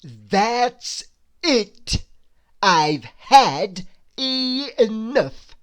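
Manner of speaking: loud, slow fed-up cry; angry outburst. A narrator's reading. Mister Uppity loudly losing patience with Perfect. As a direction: burst out, fed up. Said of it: "cried Mister Uppity suddenly".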